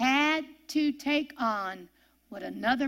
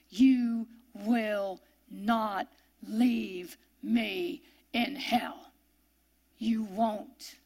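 voice of faith; much slower than usual. Retold or imitated. Jesus telling God he trusts him, slow and sure. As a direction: speak it as unshakeable trust. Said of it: "Do you hear the faith in that voice?".

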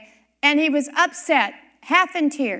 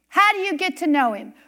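ordinary delivery

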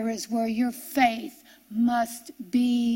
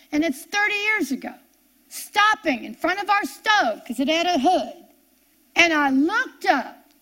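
rising, louder and higher as she tells it; building frustration; 5 semitones higher, 4 dB louder than usual. Real, not acted. Preacher reliving the night she lost patience, rising loud. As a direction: let the story climb toward the breaking point. Building up to her breaking point.

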